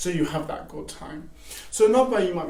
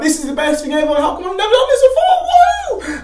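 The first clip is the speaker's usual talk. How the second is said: higher and louder, laughing, a whoop at the end; mock excitement; 12 semitones higher, 10 dB louder than usual. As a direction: gush like you found the best thing ever. Retelling binge excitement.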